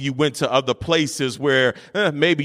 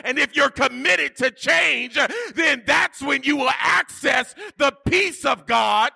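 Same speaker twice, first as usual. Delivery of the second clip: fiery preaching; 13 semitones higher than usual. Acted. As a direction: preach it out to the whole church. Preaching at full force.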